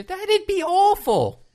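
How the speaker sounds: put-on high, slow, overdone Australian accent; bad Australian accent. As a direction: ham up a terrible Australian accent, stretched out. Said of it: "doing Australian accents on this show".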